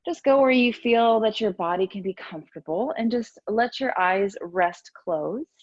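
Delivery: ordinary delivery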